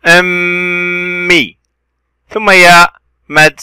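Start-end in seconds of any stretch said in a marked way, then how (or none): 0.1-1.6 "أمي" with a long, hummed m; doubled consonant. Shown as a demonstration. Teacher showing the shadda on mim, drawn-out m in "أمي". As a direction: hold the m of "أمي" very slowly, then release. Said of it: "ميم شدة وكسرة".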